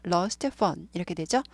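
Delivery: ordinary talk, nothing marked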